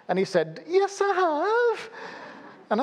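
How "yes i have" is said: high, slow, put-on wail of a distraught man; distraught caller. Retelling a panicked phone call, high wailing caller. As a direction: ham up the upset caller's plea. Caller insists he lost job.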